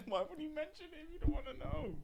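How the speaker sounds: high laughing squeal, words laughed out. Real, not acted. Friend laughing at the eye-color story while asking. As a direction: crack up and ask it through laughter.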